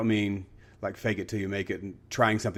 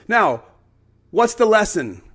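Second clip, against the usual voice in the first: high, loud teacher's question; big, projected classroom question; rousing professor; 10 semitones higher, 10 dB louder, slower than usual. Retold or imitated. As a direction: call the class to attention. Professor acted addressing excited class.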